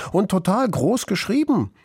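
put-on breezy kid showing off a little; casual boast. A narrator's reading. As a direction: add it offhandedly. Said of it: "sagte Kluge Pfote lässig".

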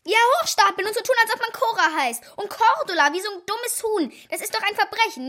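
high, loud, fast indignant rant; scandalised child insisting it is a crime; indignant outburst. Acted. Daughter outraged at father about Cordula, high and loud.

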